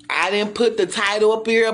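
ordinary talk

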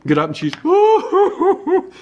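high laughing break. Real, not acted. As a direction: laugh through the words. Laughs mid-sentence.

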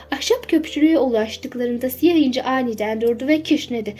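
ordinary delivery

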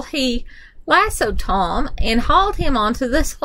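ordinary delivery

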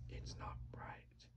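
slow unvoiced whisper. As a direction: breathe it as a secret.

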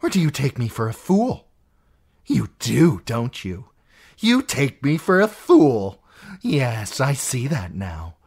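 possessive rage building; an aunt claiming Peter as hers; jealous, furious aunt. Acted. Lysa's jealous tirade.

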